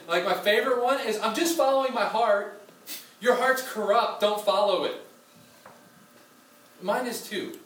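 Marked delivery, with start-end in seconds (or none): 0.1-5.0 emphatic. Real, not acted. Preacher punching a point.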